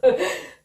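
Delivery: bright burst of laughter; real laugh. Real, not acted. Mother recalling her son's romance, laughing out loud. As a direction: laugh at the memory. Laugh after retold reply.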